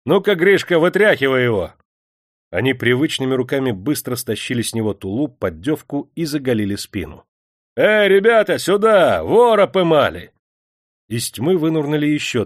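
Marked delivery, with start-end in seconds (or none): 0.0-1.7 loud, high command; raised guard's shout; rough order. A narrator's reading. Guard orders a mate to seize him. 7.8-10.3 loud, high holler; full-voiced alarm shout; calling out. A narrator's reading. Guard calls his mates over.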